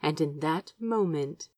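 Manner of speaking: usual speaking voice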